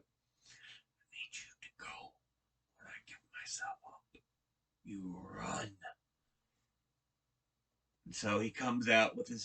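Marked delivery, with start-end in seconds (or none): 0.0-5.8 hushed, whispered instructions; acted whisper. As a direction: whisper it secretly to the two beside you. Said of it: "he whispers to Ellie and Sam".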